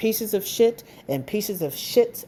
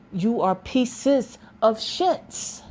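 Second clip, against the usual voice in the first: every word dragged out slowly; slow, deliberate name-calling; slow emphatic insult; 3 semitones higher, much slower than usual. Real, not acted. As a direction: lay it down word by word. Spells out her insult.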